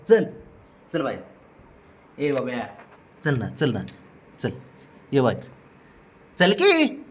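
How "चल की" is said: much higher, louder call to the dog; sing-song call; high coaxing call. Real, not acted. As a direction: lure the pet out. Calling the dog out.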